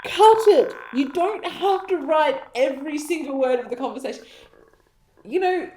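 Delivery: high, loud fed-up rant; exasperated rant. Real, not acted. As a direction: rant like a frustrated editor. Exasperated ranting.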